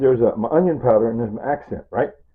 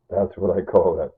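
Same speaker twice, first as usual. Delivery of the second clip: ordinary delivery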